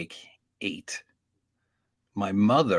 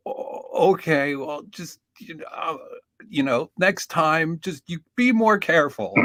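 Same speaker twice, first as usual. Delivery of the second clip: softened cop; 7 semitones higher than usual. Retold or imitated. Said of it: "the cop's like".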